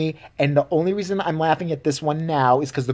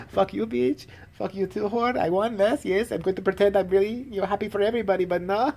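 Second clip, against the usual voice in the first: higher, hushed catty gloat; mock snide winner; 5 semitones higher, 4 dB quieter than usual. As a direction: sneer it under your breath like a fake-gracious winner. Imagined snide winner inner voice.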